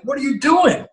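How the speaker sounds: high, slow, stunned hissing at a friend; what on earth are you doing, drawn out; high shocked scold to a friend; shocked disbelief. Retold or imitated. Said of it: "I look at Harley like".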